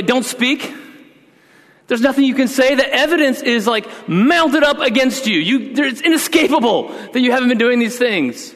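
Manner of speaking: high-pitched worked-up scolding; angry dad lecture. Retold or imitated. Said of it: "sensing that I'm angry".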